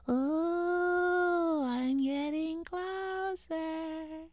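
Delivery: high, soft, slow ghostly moan; spooky ghost voice. Acted.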